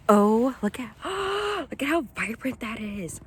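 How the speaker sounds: high, gasping amazement; real excitement. Real, not acted. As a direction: burst out, gasp, then marvel. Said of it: "I get excited about nature".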